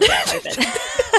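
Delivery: laughing mock bloke voice; mock tradie. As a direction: lampoon the tradie logic. Joking about immune tradies.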